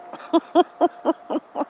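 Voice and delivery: high chuckle; real laugh. Real, not acted. Storyteller amused by her own gossip, real high chuckle. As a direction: crack up at your own gossip.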